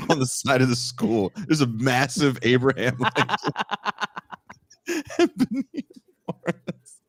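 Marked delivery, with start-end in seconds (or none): 4.9-7.0 high voice breaking up with laughter; helpless, high-pitched giggly delivery; laughing while talking. Real, not acted. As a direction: barely get the words out for laughing. Cracking up retelling the mural.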